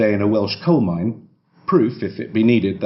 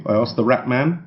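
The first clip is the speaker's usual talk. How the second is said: usual speaking voice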